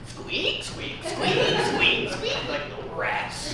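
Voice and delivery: high mocking squeaks of shiny shoes; mock squeaking. Sister mocks the man's squeaky shoes, high mock squeaks. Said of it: "Can you hear him?".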